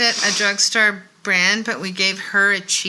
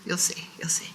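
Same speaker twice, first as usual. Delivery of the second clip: soft teasing whisper; 3 semitones lower, 8 dB quieter than usual. Real, not acted. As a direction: whisper it like a secret. Teasing the palette reveal.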